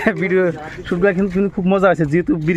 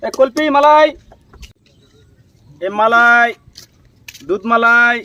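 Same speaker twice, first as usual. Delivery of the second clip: raised hawker's call, held endings; street vendor's cry; 4 semitones higher, 3 dB louder, much slower than usual. Acted. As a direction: sing out the wares to passers-by. Acting a street ice-cream seller.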